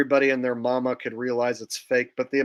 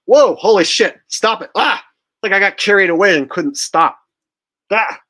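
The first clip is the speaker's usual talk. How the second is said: higher and louder, real startled laugh-shout; startled outburst; 8 semitones higher, 10 dB louder than usual. Real, not acted. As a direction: jolt out of the character, genuinely surprised at yourself. Snapping out of long impression.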